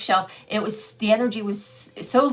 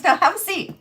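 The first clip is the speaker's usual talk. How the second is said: much higher, lightly mocking voice of the older woman; imitating the shop owner; 10 semitones higher, 5 dB louder than usual. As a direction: quote her in a high, prim put-on voice. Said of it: "She goes".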